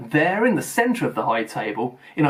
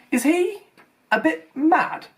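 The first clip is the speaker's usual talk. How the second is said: higher, unsure question; uncertain; slower than usual. A narrator's reading. Harry asking Percy about the headmaster, uncertain. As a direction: ask hesitantly, half afraid to. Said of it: "he asked Percy uncertainly".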